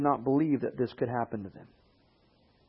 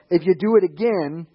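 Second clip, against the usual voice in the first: threatening dad; 5 semitones higher, 8 dB louder than usual. Retold or imitated. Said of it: "your dad with the wooden spoon".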